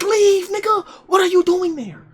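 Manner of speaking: high, loud outraged yell; retold furious yelling at his friend; mock yell. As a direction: shout him out of there.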